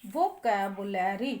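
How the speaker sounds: वोकैबुलरी slowly as vo-ka, bu-la, ree; low, slow demonstration of वोकैबुलरी, each syllable apart. Shown as a demonstration. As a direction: break वोकैबुलरी into drawn-out teaching chunks. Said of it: "वो कै के बाद ही".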